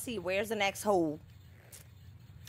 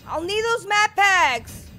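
higher and louder, fed up; angry complaint; 9 semitones higher, 7 dB louder than usual. Real, not acted. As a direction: snap it out in frustration. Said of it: "I'm super angry".

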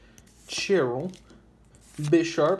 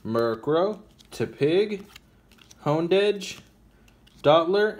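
normal talking voice

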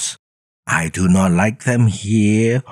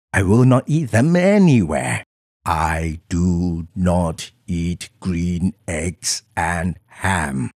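ordinary delivery, nothing marked

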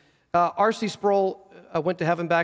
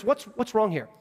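ordinary talk, nothing marked

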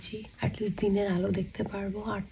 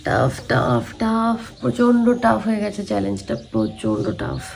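exhausted groan; 2 semitones lower, 10 dB louder than usual. Real, not acted. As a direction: groan out how tough it was. Exhausted wail at challenge. (ক্লান্ত গোঙানি)

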